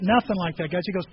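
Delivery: ordinary delivery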